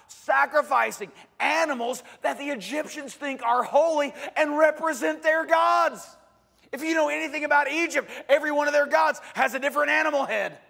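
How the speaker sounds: rising to a loud, high preaching shout, fast at the end; building from strong to full-force shout. Real, not acted. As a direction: hammer it home to the congregation.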